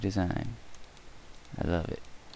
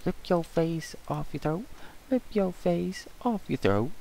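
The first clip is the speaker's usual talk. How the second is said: much higher, strained crazy chant; 10 semitones higher than usual. Acted. Vlogger acts pressing the crazy button, high unhinged voice. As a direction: go full unhinged cartoon. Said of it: "Watch me hit the cray button".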